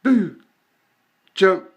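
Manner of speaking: 1 loud call to cat. Real, not acted. Calling cat to jump.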